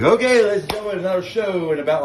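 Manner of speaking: high, loud showman announcement; announcer voice. Retold or imitated. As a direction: call it out like a bar emcee. Bar owner announcing the show.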